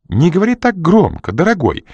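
высоко и громко, с упрёком; одёрнуть шумного мужа; крик женщины. A narrator's reading. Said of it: "закричала женщина". (женщина кричит мужу с улицы)

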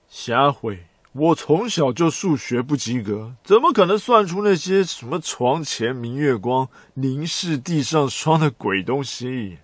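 loud exasperated outburst; shouting. Pretend. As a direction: yell it out in protest. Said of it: "不用急着大喊".

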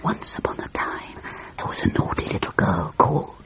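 hushed bedtime-story whisper; storytelling stage whisper. A narrator's reading. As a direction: whisper the opening of a secret tale. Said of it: "in a loud whisper she began".

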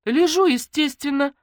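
high, loud, slow retort; wounded indignation; offended. A narrator's reading. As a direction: draw it out in a huff. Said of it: "обиделась Наташка".

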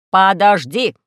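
loud indignant cry; outraged robot shouting wait; robot indignantly cutting Alice off. Acted. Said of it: "возмутился робот".

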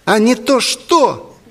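high, slow, defiant falsetto; emboldened defiance. A narrator's reading. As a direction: throw the threat back, high-voiced. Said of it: "мандраж публичного выступления сменился куражом".

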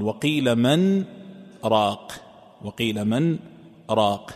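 "وقيل من" then a short stop before "راق"; slow, nun of "من" sounded, then a pause; clear nun with pause. Shown as a demonstration. Sheikh demonstrates Asim's reading with a pause before raq. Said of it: "يقرأها بإظهار النون".

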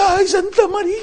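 high mock panicked shriek of a passenger; panicked passengers' cry. Preacher imitating terrified airline passengers, high panicked cry. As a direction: cry out to the saint like a frightened flyer. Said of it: "la gente empezó a gritar".

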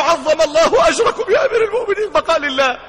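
high, sobbing condolence, acted out; put-on crying voice, pitched high; mock tearful wail. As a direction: imitate someone weeping as he speaks. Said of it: "أنت بتعيط ليه؟".

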